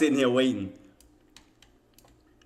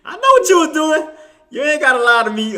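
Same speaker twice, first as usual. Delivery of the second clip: higher, louder playful accusation; playful teasing; 13 semitones higher, 6 dB louder than usual. Real, not acted. To viewer Coldplay, teasing accusation. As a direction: call him out with a grin.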